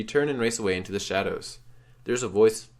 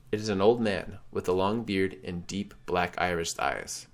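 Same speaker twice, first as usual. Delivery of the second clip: normal talking voice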